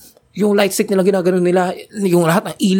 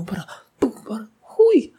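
awed whisper; 5 semitones higher than usual. Retold or imitated. Awed reaction.

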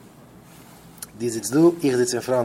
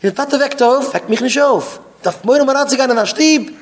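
high, raised quarrelling voice; acted indignant protest; 8 semitones higher, 4 dB louder than usual. Father wakes the sleeper, high indignant protest.

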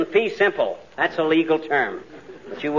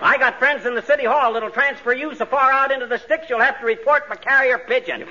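indignant outburst; 7 semitones higher than usual. Acted. Husband snapping back at the cop who told his wife to pipe down, indignant and higher. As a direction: puff up and tell the copper off.

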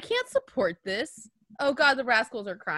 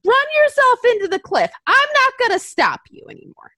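exasperated outburst; 11 semitones higher, 8 dB louder than usual. Retold or imitated. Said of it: "And I'm just like".

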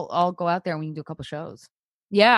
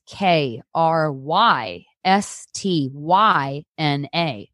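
spelled out slowly; much slower than usual. Real, not acted. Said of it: "Can you spell".

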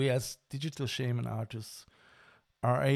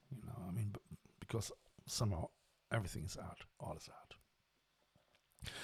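whispering; 4 semitones lower, 13 dB quieter than usual. Real, not acted. Hushed musing about art.

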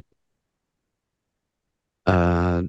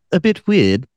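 quoted commenter voice; 7 semitones higher than usual. Acted.

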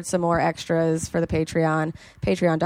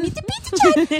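very high, loud cartoonish squeal; silly put-on voice; 4 dB louder than usual. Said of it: "I love that voice".

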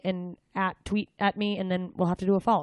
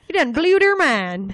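slow, loud, high Southern drawl; mock Southern accent; 8 semitones higher, 8 dB louder, slower than usual. Host mocks her amazement, hammed-up Southern accent. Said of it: "Why did it have to go Southern?".

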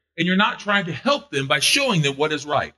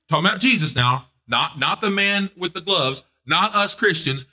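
ordinary talk

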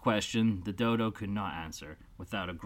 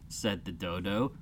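plain reading voice

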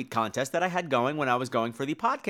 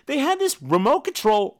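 ordinary talk

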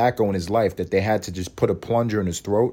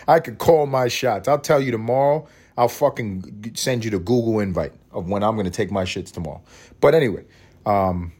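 ordinary talk

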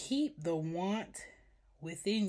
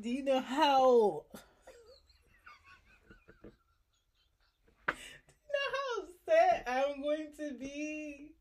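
exasperated; 10 semitones higher, 5 dB louder than usual. Real, not acted. Imagining frustration, laughing.

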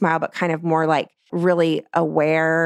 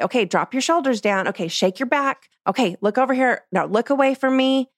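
put-on bright, higher coaching voice to kids; upbeat photographer directions; 6 semitones higher than usual. Shown as a demonstration. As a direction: call out poses cheerfully.

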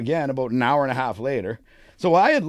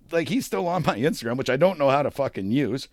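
normal talking voice

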